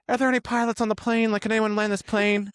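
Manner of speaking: high, fast, frantic cabin crew call; frantic flight attendant asking for help; panicked flight attendant. Retold or imitated. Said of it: "the air stewards come around and they're like".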